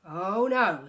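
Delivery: loud exasperated groan. Acted. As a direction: cry it out, fed up. Mister Bear loud complaint.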